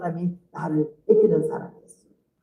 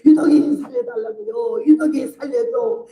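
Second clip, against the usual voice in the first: high, crying plea in Jeolla dialect; tearful pleading prayer; 7 semitones higher than usual. Retold or imitated. 권사 praying over the dying narrator, weeping Jeolla dialect plea. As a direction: beg God through tears, voice breaking high. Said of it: "울면서 기도를 하더니".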